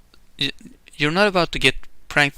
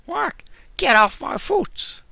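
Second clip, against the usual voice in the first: a hint of a squawky duck, much higher; half-hearted duck voice, much higher; attempted Donald voice; 13 semitones higher, slower than usual. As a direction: try a Donald Duck yelp and mostly miss. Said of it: "I can't do Donald's voice".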